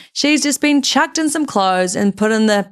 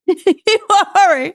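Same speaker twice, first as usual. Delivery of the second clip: laughing; 6 semitones higher, 3 dB louder than usual. Pretend. Laughing mock American line.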